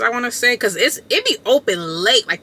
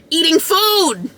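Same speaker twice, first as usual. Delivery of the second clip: mocking; 7 semitones higher, slower than usual. Mocking Marcus's excuse.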